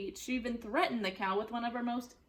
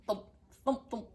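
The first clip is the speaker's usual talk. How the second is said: usual speaking voice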